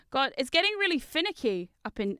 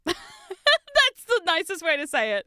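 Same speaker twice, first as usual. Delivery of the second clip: laughing; 6 semitones higher, 5 dB louder, slower than usual. Real, not acted. Laughing at own euphemism.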